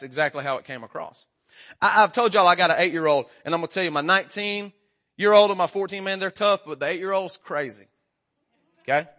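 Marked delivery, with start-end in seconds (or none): none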